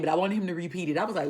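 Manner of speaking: ordinary talk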